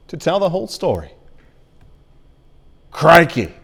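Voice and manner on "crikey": loud hammed-up Australian "Crikey.", stretched with a falling tune; Australian accent. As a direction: belt out "Crikey." like a mock Aussie croc hunter. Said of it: "Insert your own Australian accent right there".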